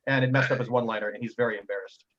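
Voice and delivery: ordinary delivery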